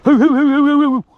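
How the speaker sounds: high, loud hooting call; calling hoots. Real, not acted. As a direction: hoot loudly to urge the dogs on. Calling the dogs in the field.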